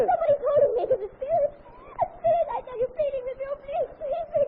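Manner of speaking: high, sobbing pleas; tearful pleading. Acted. Girl crying that a spirit is pleading with her, sobbing. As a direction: cry out please in desperation.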